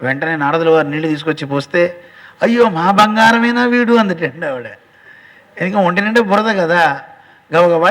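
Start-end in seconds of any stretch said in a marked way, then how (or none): 2.4-3.9 a higher, louder cry of sudden recognition; mother's surprised cry. Acted. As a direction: gasp it out as she realises it's her boy. Mother recognises her son.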